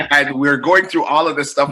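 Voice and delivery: ordinary talk, nothing marked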